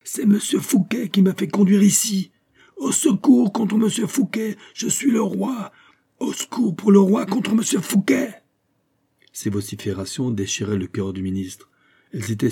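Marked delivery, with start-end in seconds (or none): 0.0-8.4 a mad king screaming through the door; the imprisoned king screaming for help against Fouquet; frenzied yelling king. A narrator's reading. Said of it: "criait à tue-tête".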